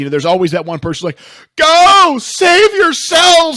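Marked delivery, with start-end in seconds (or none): 1.6-3.6 loud, high, drawn-out heroic shout; self-sacrificing movie hero. Acted. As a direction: yell it like a doomed action hero.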